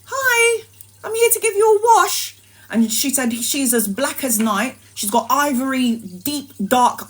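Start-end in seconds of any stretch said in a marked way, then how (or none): 0.1-2.4 very high, bright little nurse voice; the little nurse greeting mum, very high and sweet. Retold or imitated. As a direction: chirp it like a tiny cheerful nurse.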